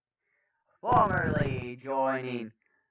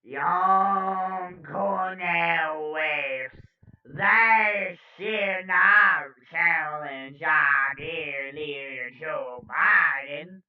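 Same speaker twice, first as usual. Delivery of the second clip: very slow, loud evil emperor; hammed-up villain proclamation; Palpatine voice; 2 semitones higher, 5 dB louder, much slower than usual. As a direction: draw every word out like a menacing decree. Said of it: "I'll give him a Palpatine voice".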